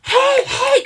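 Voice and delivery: high, loud bar-customer shout; mock customer yell. Rude customers shouting for drinks, high loud call. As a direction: yell for service over the crowd. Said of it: "people screaming at me".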